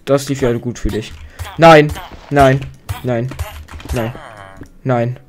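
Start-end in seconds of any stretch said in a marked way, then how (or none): 1.6-5.2 loud, alarmed outburst; alarmed cry. Real, not acted. Player seeing the villager ride go wrong, loud alarm. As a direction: cry out in sudden dismay.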